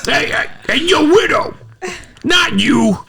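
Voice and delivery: high, strained mobster-boss impression; Hollywood Tony voice. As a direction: squeal it like a sleazy Russian boss. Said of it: "hates fucking Hollywood Tony".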